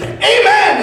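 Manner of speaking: high, loud, fervent amen; a joyful loud amen; shouted amen. Retold or imitated. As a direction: bellow amen with joy. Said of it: "And he goes".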